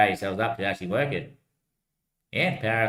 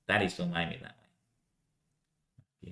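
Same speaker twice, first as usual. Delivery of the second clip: much softer, lower echo of the line; quiet repeat; 6 semitones lower, 10 dB quieter than usual. Acted. Repeating the line to himself, quietly. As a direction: drop it to a murmur.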